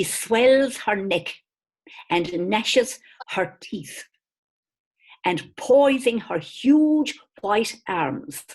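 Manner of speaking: slow, weighty recital with rising emphasis; dramatic reading. Acted. Reading an ancient writer's account of Celts to listeners, slow and grand. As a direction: read it out like an old chronicle, savouring each phrase. Reads classical quote dramatically.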